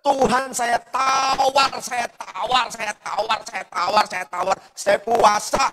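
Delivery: loud, high, slow bargaining plea that fades a little; desperate cry to God. Retold or imitated. Pastor reliving bargaining with God for mother, crying out loud. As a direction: cry out to God begging for a deal. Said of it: "saya, saya jerit".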